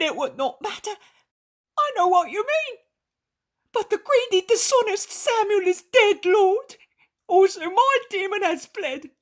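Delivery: high, loud, laughing with joy; a changed man exults before his guest; joyful innkeeper. Acted. Innkeeper rejoices, transformed.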